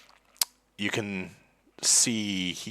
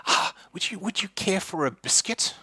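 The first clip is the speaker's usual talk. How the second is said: put-on high, eager old hostess; old lady offering; 11 semitones higher than usual. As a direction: coax them to take a biscuit. Agatha offers biscuits.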